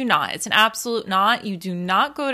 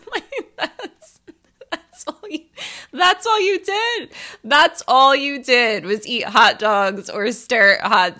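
high, breaking up with laughter; losing it laughing; 4 semitones higher than usual. Real, not acted. Daughter repeating dad's hot dog verdict, losing it laughing. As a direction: repeat it through real laughter, pitch jumping up. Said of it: "I lost my shit".

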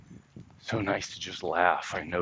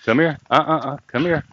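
high, loud calls to a dog; calling a dog; 8 semitones higher, 9 dB louder than usual. Real, not acted. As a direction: call the dog back in a high voice.

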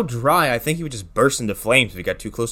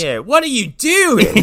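higher, drawn-out Australian exasperation; mock Australian horror; 9 semitones higher, 3 dB louder, slower than usual. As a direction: wail it in a hammed-up Australian accent. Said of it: "screaming in horror this entire film".

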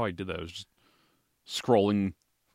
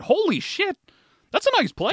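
surprised; 4 dB louder than usual. Retold or imitated. Retold surprised reaction.

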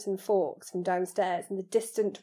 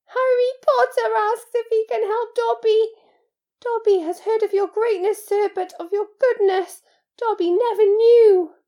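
high, loud elf wail; Dobby, overcome with gratitude; 14 semitones higher, 10 dB louder than usual. Acted. House-elf praises Harry's goodness, wailing with gratitude. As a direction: gush in a high-pitched creature voice.

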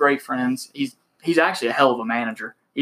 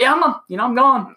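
much higher, louder huffy goodbye; defiant exit; 10 semitones higher, 4 dB louder than usual. Retold or imitated. Fired salesman storming out, defiant and loud. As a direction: announce your exit defiantly.

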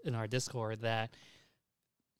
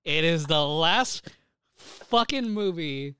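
emphatic; 4 semitones higher, 9 dB louder, much slower than usual. Real, not acted. Excited about long-awaited final movie.

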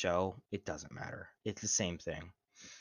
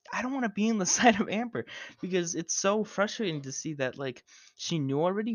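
frustrated rant; 9 semitones higher, 3 dB louder than usual. Real, not acted.

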